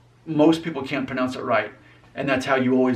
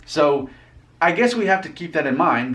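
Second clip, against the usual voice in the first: ordinary talk, nothing marked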